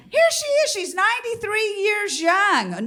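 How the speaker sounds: high, sing-song host voice; gushing showbiz announcer voice, slowing on years young. Retold or imitated. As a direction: present her like a TV host.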